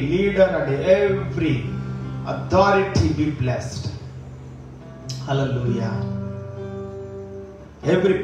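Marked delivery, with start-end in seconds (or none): none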